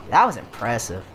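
ordinary talk, nothing marked

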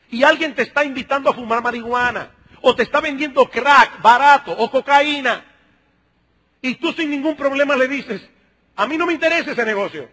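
ordinary talk

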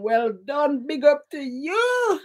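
high, drawn-out shout-out; real congratulation. Real, not acted. Host to Hugh, who got the prime minister right: excited shout-out. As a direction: stretch it out high to cheer the winner.

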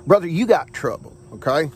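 usual speaking voice

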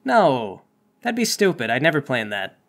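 ordinary talk

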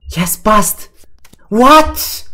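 high, loud disbelieving shriek; real shock at the cards. Real, not acted. Dealer hand flips blackjack.

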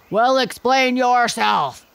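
slow, loud, commanding growl; gorilla demanding. A narrator's reading. Gorilla to my father, slow and commanding. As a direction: loom over him and demand it. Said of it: "said the gorilla".

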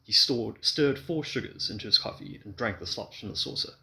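usual speaking voice

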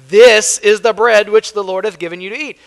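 high, loud proclamation from scripture; Moses proclaiming. Acted. Moses telling the Israelites about the bread, loud proclaiming. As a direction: announce it boldly to a crowd. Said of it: "And Moses said unto them".

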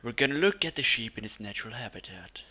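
hushed wildlife narrator whisper; nature documentary. Pretend. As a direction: whisper like watching wild animals. Mock wildlife show narration.

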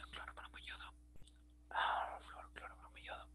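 whispering to herself while writing; hushed, voiceless muttering of the names. Real, not acted. Teacher whispers elements while writing. (susurro para sí misma)

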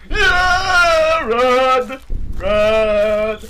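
loud frightened yell; panicked scream. Real, not acted. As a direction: scream it, terrified. Scared screaming while fleeing.

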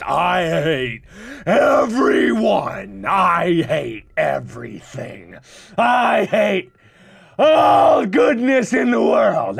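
slow, drawn-out shouts of hatred; hateful ranting. Real, not acted. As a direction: roar out each word in over-the-top rage. Ranting about the wasted time.

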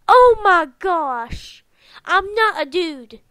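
loud, drawn-out disbelief; shocked outburst. Real, not acted. Player taking in the friend's confession, loudly stunned. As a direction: stretch it out slowly and loudly, stunned. Shocked again at game reveal.

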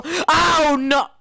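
high, loud cry of dismay; dismayed yell. Real, not acted. Gamer to stream as things go wrong, dismayed yell. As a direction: wail it out in alarm. Sudden mishap in game.

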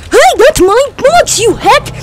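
loud, high-pitched protest; high squeaky indignant yell; high indignant shout. Acted. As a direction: yell it high like a cartoon kid. Character protests stolen box.

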